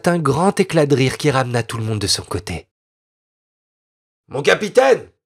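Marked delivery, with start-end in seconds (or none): 4.3-5.1 laughing soldier. A narrator's reading. Soldier laughing calls captain.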